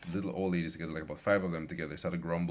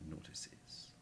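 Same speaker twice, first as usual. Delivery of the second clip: whisper; 18 dB quieter than usual. Retold or imitated. End of rant trails off.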